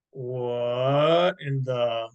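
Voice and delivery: very slow, drawn-out astonishment; slow, trailing bewilderment; stunned disbelief. A narrator's reading. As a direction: stretch each word in amazement. Father stunned by rising fish.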